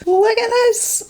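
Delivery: squealing with delight, high; gushing over the shimmer; excited. Real, not acted.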